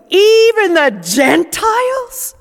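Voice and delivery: high, loud, drawn-out surprise; astonished, rising high; shocked emphasis. Real, not acted. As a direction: land the shock hard. Paul's shock at inclusion.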